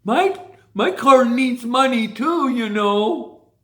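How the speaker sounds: slow, mock-whiny begging driver; lightly hammed-up complaining driver. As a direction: drag it out like a needy racer. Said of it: "rather than with the".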